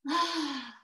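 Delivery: demonstrative sigh, settling back; relieved sigh. Acted. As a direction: let out an acted sigh of relief. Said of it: "you're probably already like".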